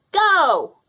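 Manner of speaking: high, loud screeched Go. Acted. Fowler screeches the race start at Henry, high and loud. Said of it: "Fowler screeched".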